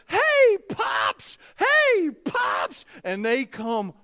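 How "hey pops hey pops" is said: put-on high-pitched shout of a child running up; excited grandkids calling. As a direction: holler like thrilled little grandsons. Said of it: "they go".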